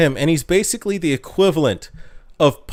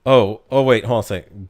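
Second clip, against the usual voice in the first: usual speaking voice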